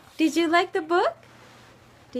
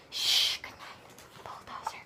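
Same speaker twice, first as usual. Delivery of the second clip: whispered; 8 dB quieter than usual. Real, not acted. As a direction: breathe it so he stays asleep. Bedtime goodnight to bulldozer.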